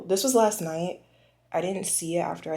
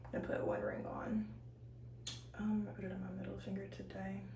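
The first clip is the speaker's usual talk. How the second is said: whispering; 11 dB quieter, faster than usual. Real, not acted.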